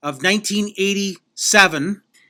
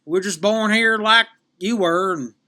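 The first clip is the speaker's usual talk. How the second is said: folksy southern parents, pitched up; southern accent; 5 semitones higher than usual. Acted. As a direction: put on a country southern accent. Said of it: "I should drop the southern accent".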